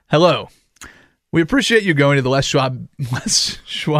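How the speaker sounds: loud announcer sponsor read. Pretend. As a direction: boom it like a radio ad.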